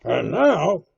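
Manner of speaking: loud, high resumption; aloud again. A narrator's reading. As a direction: speak up for the whole room. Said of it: "he ran on again, aloud".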